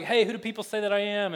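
silly, overplayed campfire question; hammy bad acting. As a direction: toss it out like a bad comedy-skit actor. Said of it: "it's really silly and just bad acting".

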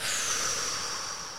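long, soft breath out; demonstrated exhale sigh. Shown as a demonstration. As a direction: show a relaxing exhale. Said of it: "there's this exhale".

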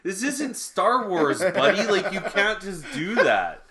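high, loud, laughing incredulity; amused mock outrage, slow on star wars; laughing disbelief. Retelling disbelief at surgeon's claim.

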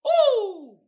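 high, loud delighted ooh; a loud gleeful squeal; excited ooh. Real, not acted. Kicking off meme time for viewers, loud high ooh.

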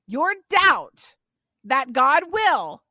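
ordinary talk, nothing marked

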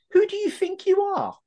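high-pitched mock challenge; indignant quote. Host mimicking a phrase that pisses people off, indignant. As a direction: pitch it right up like someone affronted.

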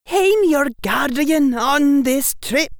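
high, loud, slow warning; tinge of danger. A narrator's reading. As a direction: assert authority with a dangerous edge. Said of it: "said with a tinge of danger".

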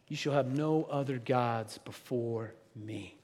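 slow, low solemn reading; a slow, grave intoned command; solemn commandment. Pretend. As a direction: intone it like scripture, slowly.

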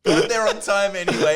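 ordinary talk

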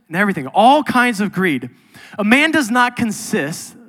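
loud, insistent preacher's build; emphatic sermon voice; preaching at full force. Acted. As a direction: hammer the point home. Preaching climax.

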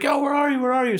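high-pitched imitation of a friend calling back; retold calling out. Friend answering from across the creepy building, calling out.

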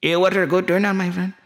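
high-pitched mock Middle Eastern accent; Saudi friend. Saudi host agrees about the turnout, high accented caricature. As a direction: echo it as an eager Saudi host. Saudi character echoes own remark.

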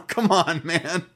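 usual speaking voice

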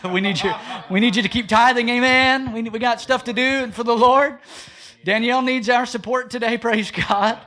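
loud, laughing push for tithes; rally the church with a laugh; joking loud plea for giving; laughing appeal. Real, not acted.

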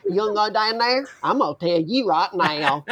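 put-on South Carolina accent. College-in-the-South speaker slipping back, undignified South Carolina accent. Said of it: "If I step foot in South Carolina".

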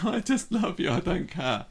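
high, besotted puppy voice; devoted puppy. Retold or imitated. Host voicing the bonked puppy John, high and devoted.

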